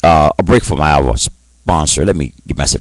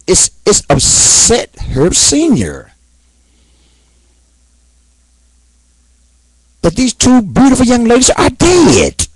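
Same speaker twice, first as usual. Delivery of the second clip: angry outburst. Real, not acted. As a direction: let the grief and anger burst out. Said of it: "let me just kinda calm down".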